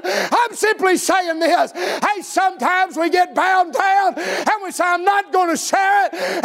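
driving revival sermon cadence; full-force preaching. Real, not acted. Preacher rallying church to spread good news, full-force preaching. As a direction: preach it hard, stretching the big phrases.

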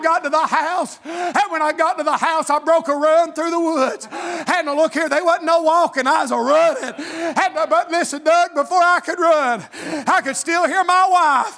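high, loud country revival preacher; full-force preaching. Real, not acted. Preacher telling his church of running through the woods, full-force preaching. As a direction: preach the story in driving, rhythmic bursts.

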